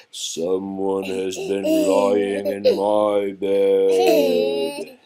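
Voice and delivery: loud, very slow big-bear growl; Papa Bear. A narrator's reading. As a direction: growl it out, swelling and stretching each word. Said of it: "growled in his great big voice".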